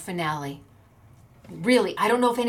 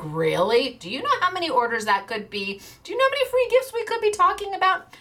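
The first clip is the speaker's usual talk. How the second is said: retold disbelief; 9 semitones higher than usual. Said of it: "I'm like".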